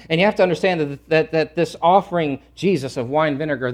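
ordinary talk

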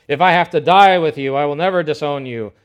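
emphatic insistence. Acted. Peter loudly insisting he will never disown Jesus. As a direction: insist hard, almost shouting. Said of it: "Peter insisted emphatically".

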